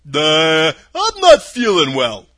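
loud, husky scarecrow voice. A narrator's reading. Scarecrow answering Dorothy from his pole, husky. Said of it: "in a rather husky voice".

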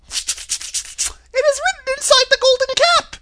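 very high, loud squeaky mouse; tiny squeaky royal mouse voice; Queen of the Mice. A narrator's reading. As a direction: squeak it out slowly. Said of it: "in her squeaky little voice".

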